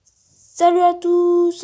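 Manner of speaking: slow, high, loud altered voice; drawn-out app-morphed greeting; voice changer. Shown as a demonstration. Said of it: "changeant de voix".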